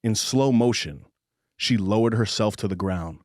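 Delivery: slow, low romance narration; audiobook narration. Acted. Host reading a spicy romance passage aloud, measured narration. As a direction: read it like a slow, intimate audiobook.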